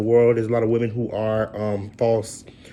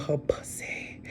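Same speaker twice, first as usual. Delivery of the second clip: whispered; 3 semitones higher, 12 dB quieter, much slower than usual. Real, not acted. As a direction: drop to a whisper on "her pussy". Hushing a crude word on air.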